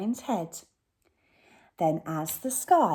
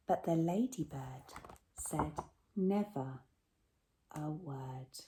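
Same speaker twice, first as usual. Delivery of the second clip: whisper; 4 semitones lower, 11 dB quieter than usual. A narrator's reading. Closing line fading to hush.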